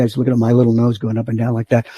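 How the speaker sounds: usual speaking voice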